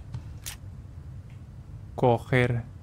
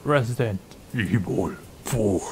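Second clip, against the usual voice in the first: lower, very slow announcer voice drawing out the title; game title announcer; 4 semitones lower, much slower than usual. Retold or imitated. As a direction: intone the title like the game's trailer narrator. Mimicking the game's title announcer. (voz de locutor de tráiler, grave y muy lenta)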